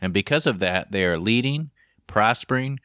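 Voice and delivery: upbeat announcer-style show opener; podcast intro read. Acted. Recorded podcast opener over music.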